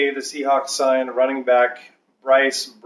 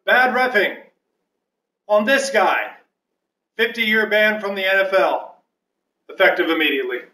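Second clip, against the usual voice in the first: referee penalty announcement; 7 semitones higher than usual. Pretend. Mock referee hands out ban.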